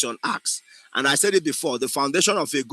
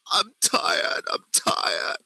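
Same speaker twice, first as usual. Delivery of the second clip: sobbed words fading into breath; crying, tone dropping; 3 semitones higher, 3 dB quieter, much slower than usual. Shown as a demonstration. Acting teacher shows the tone dropping at the end, crying. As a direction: let the wail collapse into weak, tearful gasps. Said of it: "the tone is dropping".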